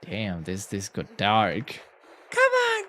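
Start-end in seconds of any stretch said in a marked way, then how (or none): 2.3-2.8 high, urging cheer; playful high-pitched demand for a speech; egging on. Acted. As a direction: press the captain to speak up. Urging the captain to speak.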